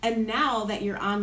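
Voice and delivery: ordinary talk, nothing marked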